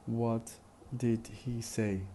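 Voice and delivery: "What did he say?" word by word, did and he in full; careful speech. Shown as a demonstration. Teacher pronounces examples for students, in careful speech. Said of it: "Once in, uh, careful speech".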